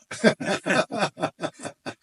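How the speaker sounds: loud high laughter; real laugh. Real, not acted. Laughing at his own ignorance of Minnesota, loud and high. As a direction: break up laughing.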